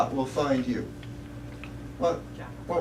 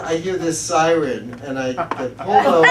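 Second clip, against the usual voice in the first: usual speaking voice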